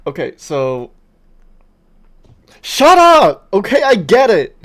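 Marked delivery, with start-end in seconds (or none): 2.6-4.6 much higher and louder, fed up; loud exasperated shout. Real, not acted. Gamer yells at friend to shut up. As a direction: yell it to make them stop.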